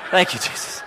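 breathy laughing whisper of thanks. Retold or imitated. Chaplain whispering thanks to Jesus in the truck, laughing. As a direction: whisper it to yourself, relieved. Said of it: "I'm like".

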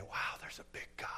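whispered in awe; awed whisper. Retold or imitated. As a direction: breathe it out, amazed. Awed aside in a retold walk.

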